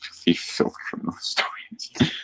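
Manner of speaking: soft unvoiced echo; whispered. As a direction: breathe the words back. Echoing the player's phrase.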